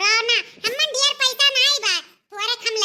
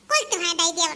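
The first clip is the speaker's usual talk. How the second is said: ordinary talk, nothing marked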